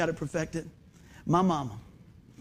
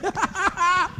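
high burst of laughter; 11 semitones higher than usual. Real, not acted. Preacher laughing at his joke about backhanded encouragement, high laugh. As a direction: crack up at your own joke.

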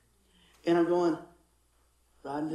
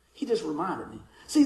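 ordinary delivery

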